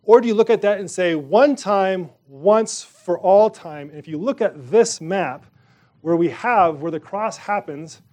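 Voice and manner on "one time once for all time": ONE and ALL leaned on, drawn out slowly; "One time, once for all time" hammered home slowly with ONE and ALL hit hard; slow emphatic stress. Real, not acted. Preacher to congregation, slow hammering stress on ONE and ALL.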